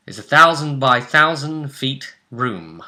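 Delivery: ordinary talk, nothing marked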